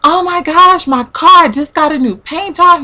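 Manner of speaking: high-pitched retold wail over the car; mock screaming. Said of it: "screaming and yelling".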